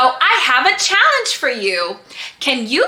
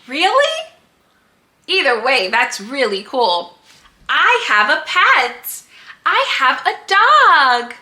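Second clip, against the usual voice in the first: put-on reading to a toddler. Teacher asks toddlers questions.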